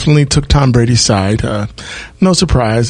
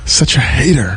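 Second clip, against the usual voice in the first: whispering. Real, not acted. Teasing retort, whispered.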